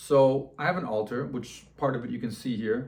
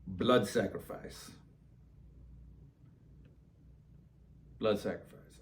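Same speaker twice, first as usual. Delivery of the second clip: spirit voice; 4 semitones lower, 9 dB quieter than usual. Retold or imitated. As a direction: intone it like a voice from beyond. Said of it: "there's a voice in my head".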